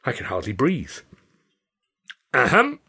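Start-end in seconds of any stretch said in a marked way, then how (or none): 2.3-2.8 high, pointed ahem to get attention; mock polite cough; theatrical throat clear. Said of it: "Carno coughed".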